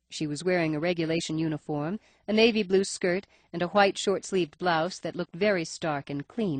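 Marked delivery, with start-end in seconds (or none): none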